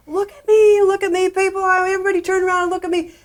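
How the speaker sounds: high mock shouting; attention-grabbing shout. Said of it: "you start shouting".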